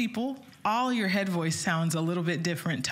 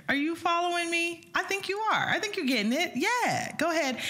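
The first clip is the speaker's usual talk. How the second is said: playful; 12 semitones higher than usual. Real, not acted. Teacher teasing the viewers.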